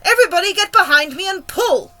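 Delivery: loud, high rallying command; rally the crew with a big shout. A narrator's reading. Grasshopper leading the others hauling the string, loud rallying shout. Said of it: "shouted the old green grasshopper".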